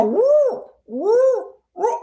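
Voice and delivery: high woos turning into crying meows; imitating a cat switching to meows. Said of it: "They go".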